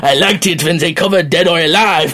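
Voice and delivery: a bad, hammed-up French accent; terrible French accent. A podcaster voicing Macron listening, in a bad French accent. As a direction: put on a clumsy mock French accent, playing Macron. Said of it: "That was a terrible French accent".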